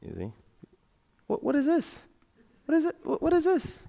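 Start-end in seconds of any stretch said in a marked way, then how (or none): 1.3-3.8 high, hushed, puzzled questioning; higher, softer, puzzled; mock puzzled discovery. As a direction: wonder aloud what on earth this is. Discovers the voice figure on stage.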